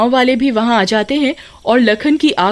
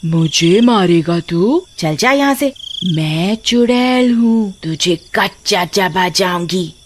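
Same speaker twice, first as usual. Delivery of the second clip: slow, menacing witch voice; 4 semitones lower, slower than usual. Acted. Witch warning off the man with the fire, slow menace. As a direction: drag the threat out like a witch. Masked witch threatens Kabir.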